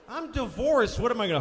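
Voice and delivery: normal talking voice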